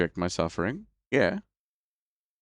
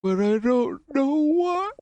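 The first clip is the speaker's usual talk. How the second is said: much higher, slow squeaky falsetto; 4 dB louder, much slower than usual. Pretend. Joking to friend about brain overload, high squeak. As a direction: squeak it out like a cartoon.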